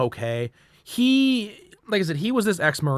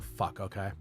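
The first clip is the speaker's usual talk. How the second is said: resigned; 8 semitones lower, 15 dB quieter than usual. Retold or imitated. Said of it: "And I was like".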